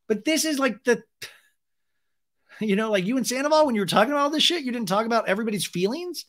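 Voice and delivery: high-pitched, heated disbelief; incredulous rant. Real, not acted. Heated challenge to the absent woman.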